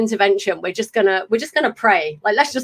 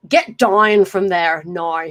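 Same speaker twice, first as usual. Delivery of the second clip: slow, attempted Northern Irish accent; 2 semitones lower, slower than usual. Shown as a demonstration. Host showing off her practised accent, Northern Irish accent. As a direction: put on a drawn-out Northern Irish twang. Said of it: "working on an Irish accent".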